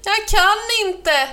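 high, loud, stretched wail; alarmed and drawn out; panicked cry. A narrator's reading. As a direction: cry out helplessly while careering along. Panicking cow heading for ditch.

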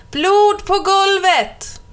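put-on high, slow, excited kids' shout; high child's call; children calling out. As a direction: cry it out like thrilled schoolkids. Schoolchildren shouting about blood.

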